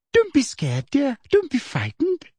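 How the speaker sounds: high, sweet coaxing voice; soft sugary Umbridge. A narrator's reading. Umbridge soothing the scared girl, high and sugary. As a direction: coo at her like a false auntie. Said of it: "said Professor Umbridge softly".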